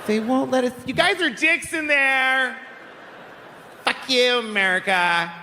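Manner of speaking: hammed-up Mexican accent, yelling at the border; mock Mexican accent. Turned away at the border, high mock Mexican accent. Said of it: "offensive stereotype of a Mexican".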